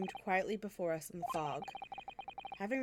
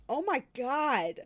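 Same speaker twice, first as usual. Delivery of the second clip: shocked exclamation; 5 semitones higher, 6 dB louder, much slower than usual. Real, not acted. Reacts to governor's insult.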